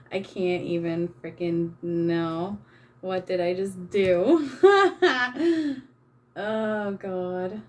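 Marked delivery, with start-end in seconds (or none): 4.5-5.8 higher, louder laugh on the edge of tears; tearful laugh. Real, not acted. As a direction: laugh at the disaster. After "what did I just do.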